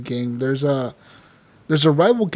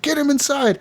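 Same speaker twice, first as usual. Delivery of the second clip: urgent high commands to get everyone inside; Dutch barking orders; 11 semitones higher than usual. Retold or imitated. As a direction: play the gang leader yelling orders under fire, pitched high. Dutch orders during attack.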